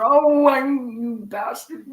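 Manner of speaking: high, loud mock ranting, slowing on bastard; imitated rant. Woman ranting at him, loud mock fury. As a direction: ham up her shrill outburst. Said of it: "And she started ranting".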